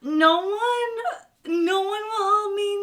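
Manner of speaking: high, drawn-out fake sob; hammed-up helpless lament; mock wailing. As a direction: whine it out like a melodramatic victim. Shopper laments back at hotel.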